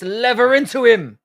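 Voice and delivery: loud, high urging yell; cheering shout. Real, not acted. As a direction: push the fighter on with a shout. Cheering on a boxer.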